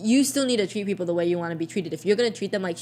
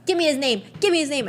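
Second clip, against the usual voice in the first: higher, louder insistent demand; 8 semitones higher, 5 dB louder than usual. Retold or imitated. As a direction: insist like an angry customer, mock. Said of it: "she was just like".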